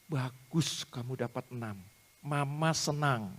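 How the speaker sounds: slow, soft motherly praise; demonstrated praise. Shown as a demonstration. Tutor models a mother praising her child's six, slow and tender. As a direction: praise gently, drawing out the words. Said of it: "Ibu puji".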